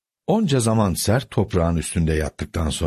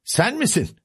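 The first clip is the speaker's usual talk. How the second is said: indignant cry; 13 semitones higher than usual. A narrator's reading. Housekeeper finding Sancho at the door, indignant cry. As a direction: cry out sharply high. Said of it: "diye bağırdı kahya kadın".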